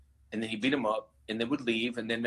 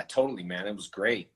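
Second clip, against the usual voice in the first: ordinary talk, nothing marked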